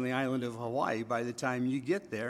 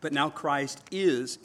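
"is" held long and pushed louder; stress on "is"; 4 dB louder, slower than usual. Shown as a demonstration. Preacher reading the verse to his congregation, stress on "is". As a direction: lean hard and slowly on "is". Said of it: "emphasis on the word is".

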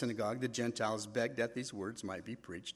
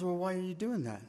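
higher, calm questioning voice; God asking gently; 6 semitones higher than usual. Retold or imitated. God questioning the pastor, higher and gentle. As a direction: probe kindly, not accusing. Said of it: "He says".

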